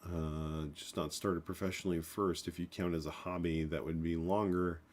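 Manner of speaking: ordinary delivery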